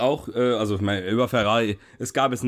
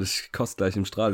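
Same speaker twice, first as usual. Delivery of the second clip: ordinary talk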